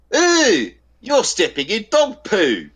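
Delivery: high, slow jeering call; mocking bloke's call. Teasing a bloke on the grass, high slow jeer. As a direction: sing it out high to wind him up. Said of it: "the way that they said it".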